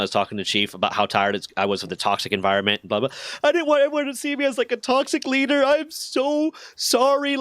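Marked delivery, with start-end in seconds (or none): 3.4-7.4 very high, whiny tearful imitation; mock weeping. Sergeant begs not to be seen as toxic, mock weeping. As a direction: wail the apology in exaggerated falsetto. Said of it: "fucking weeping".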